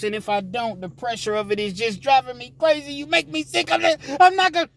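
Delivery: yelling back high and hurt; shrill girlfriend. Acted. Girlfriend venting at the inmate, high and upset.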